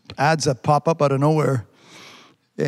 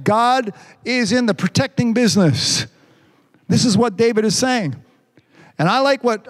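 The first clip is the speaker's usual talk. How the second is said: raised, sermon cadence; preaching; 5 semitones higher than usual. Acted. Pastor preaching to his church, full sermon voice. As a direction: preach it to the congregation.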